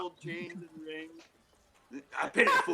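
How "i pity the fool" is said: a light tough-guy growl with a laugh; Mister T impression. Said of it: "Mister T dressed as the Pope".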